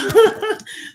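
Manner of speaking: high, loud burst of real laughter; a sudden high laugh at her puffy eyes. Real, not acted. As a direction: laugh out at herself.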